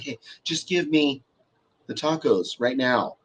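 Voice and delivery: quiet, slow insistence; measured, softly firm request; controlled demand, retold. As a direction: imitate a slow, hushed demand. Imitating Allie's firm, controlled demand.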